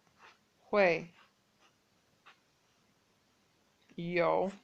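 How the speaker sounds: very slow, word by word with long gaps; dictation pace. Shown as a demonstration. Teacher spelling the question word by word while writing. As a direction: say each syllable while writing it. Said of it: "let me write that in Roma pinyin".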